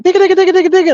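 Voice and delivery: high sing-song gibberish, imitating a Mexican accent; sing-songy mock Mexican Spanish. Teacher mocking how Mexican Spanish sounds, high sing-song. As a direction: rattle it off high and singsong. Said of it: "sing-songy 'cause his voice will get higher".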